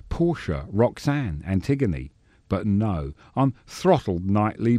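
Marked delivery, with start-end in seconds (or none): none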